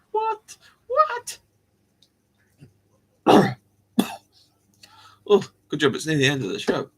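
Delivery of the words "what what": very high, squeaky "what"; incredulous squeak. Acted. Host baffled by own invented meal, squeaky disbelief.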